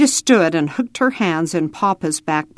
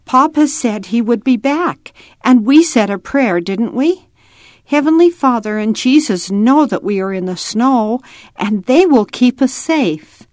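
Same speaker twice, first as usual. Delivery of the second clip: put-on higher, louder, earnest little-girl voice; reassuring child; 6 semitones higher, 4 dB louder than usual. A narrator's reading. Ada reassures Roy that God watches, earnest child's voice. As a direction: convince a little brother all is well.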